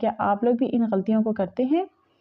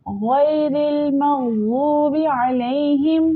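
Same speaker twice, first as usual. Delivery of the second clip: slow melodic tajweed chant; Quranic recitation; 3 semitones higher, much slower than usual. Shown as a demonstration. Teacher demonstrating the recitation to students, slow melodic chant. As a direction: draw each word out in a measured chant.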